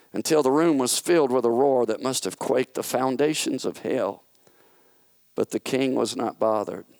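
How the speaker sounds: plain reading voice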